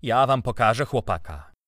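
loud, indignant warning; irritated threat. A narrator's reading. Uncle ringing for the servant, irritated. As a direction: threaten them while losing patience. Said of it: "Zirytował się, nacisnął dzwonek".

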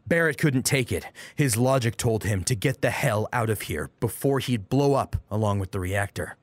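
plain reading voice

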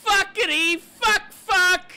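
cursing, high and loud; furious yell. Real, not acted. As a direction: scream the curses in frustration. Crash in race, cursing.

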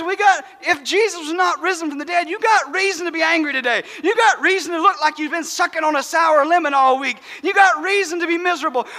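normal talking voice

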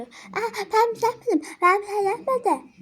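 imitating a caught kid, high and whiny; whiny denial. Caught kid protesting to the girl that he did nothing, whiny imitation.

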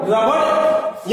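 रबड़ drawn out, ending in a clear ड़; slow model word. Shown as a demonstration. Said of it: "एक बार फिर से मैं repeat कर रहा हूं". (रबड़ धीरे और साफ़)

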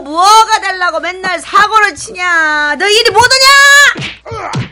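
high, shouting furious principal; angry scolding. Acted. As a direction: yell at the boys who lied. Principal scolding caught boys.

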